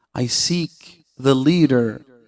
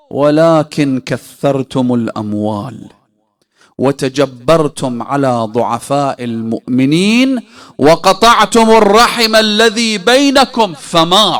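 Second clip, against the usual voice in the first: building rebuke; 7 dB louder, faster than usual. Acted. The Imam lists the believers' failings, loudly rebuking them. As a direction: drive the accusations upward to a forceful peak.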